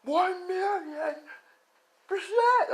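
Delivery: mock heroic declaration; hammed-up hero cry. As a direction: belt it like a cartoon hero. Over-the-top hero slogan, then stops.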